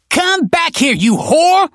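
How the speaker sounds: high screaming rage; furious woman's yell. Acted. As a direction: scream after her, furious. Enraged foster mother chases woman.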